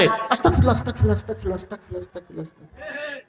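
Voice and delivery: rushed, fading, swallowed mumble; mumbling imam. Retold or imitated. Mocking an imam rushing the istighfar after salam, mumbled and fading. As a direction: gabble it under your breath until it vanishes. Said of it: "কি কইলো, কি বকা দিল".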